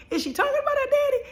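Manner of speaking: very high-pitched mock curiosity; imitated nosy listener. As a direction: squeak it like a gossiping onlooker. Voicing curious listeners gossiping.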